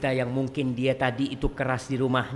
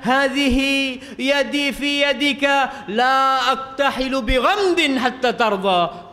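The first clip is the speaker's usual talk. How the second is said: Arabic recitation; 8 semitones higher, 5 dB louder, slower than usual. Acted.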